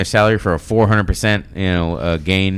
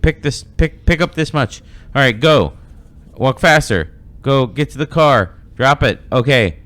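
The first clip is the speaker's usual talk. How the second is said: loud, higher-pitched orders in short bursts; stopwatch overseer; 7 semitones higher, 6 dB louder than usual. Acted. Somebody following the laborer with a stopwatch, giving loud orders. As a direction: order the worker along, louder and higher. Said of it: "had somebody following the laborer".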